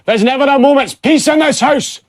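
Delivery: put-on high, loud yell in a Scottish accent; angry Scottish shout. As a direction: bellow at the household as an exasperated Scottish dad. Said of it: "Kevin shouted".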